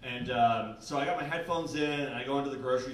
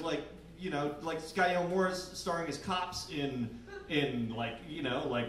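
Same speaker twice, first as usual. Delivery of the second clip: ordinary talk, nothing marked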